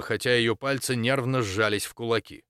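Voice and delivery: plain reading voice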